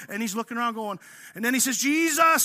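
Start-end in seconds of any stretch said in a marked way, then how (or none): 1.8-2.4 high, loud call for help; mock desperate cry. Preacher acting Peter sinking in the water, mock desperate cry. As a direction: cry the name out as if going under.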